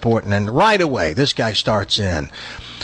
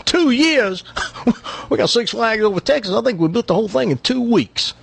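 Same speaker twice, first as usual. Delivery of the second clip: incredulous Texan, pitched way up; hammed-up Texas braggart; bragging Texan; 10 semitones higher than usual. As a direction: scoff in a high Texas accent. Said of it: "one of these Texans that brags all the time".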